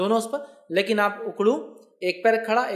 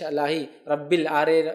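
usual speaking voice

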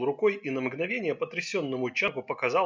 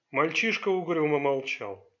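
ordinary delivery

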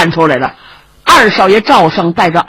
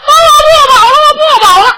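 much higher, louder desperate shout; crying out; 7 dB louder, faster than usual. Acted. Nephew shouting his vow to his uncle, desperate cry. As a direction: yell the vow, voice breaking high.

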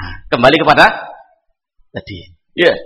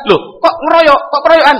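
mock alarmed question; 6 semitones higher, 4 dB louder than usual. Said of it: "Ali nggak bilang".

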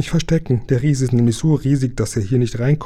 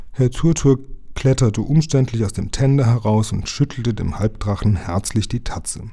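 ordinary delivery

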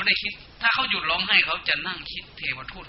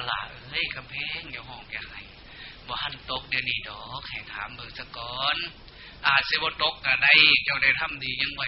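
Isan dialect; 3 semitones lower, 4 dB quieter than usual. Shown as a demonstration. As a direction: comfort like a local Isan elder, gently. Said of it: "คันแม่นเว้าภาษาเฮาว่า". (ปลอบใจด้วยภาษาอีสานช้าๆ เบาๆ)

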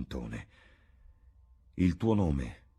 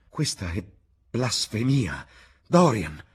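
rising to a louder cry on his name; shocked outcry; 6 semitones higher, 7 dB louder, slower than usual. A narrator's reading. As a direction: recoil and protest, appalled. Basil shocked by Dorian's words.

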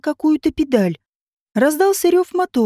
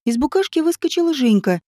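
usual speaking voice

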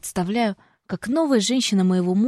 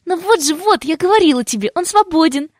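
much higher, louder triumphant cry; 9 semitones higher, 6 dB louder than usual. A narrator's reading. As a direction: announce it like a victory. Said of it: "торжественно заключает Янка".

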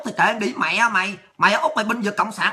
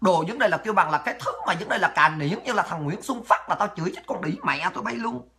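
ordinary delivery

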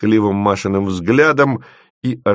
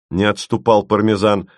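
usual speaking voice

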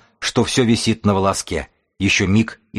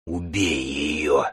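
growled demand; 3 semitones lower, much slower than usual. A narrator's reading. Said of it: "прорычала жрица".

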